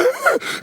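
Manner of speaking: high-pitched crazed shriek; wild wordless yelling; crazy shrieking. Retold or imitated. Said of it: "I go ten times crazy".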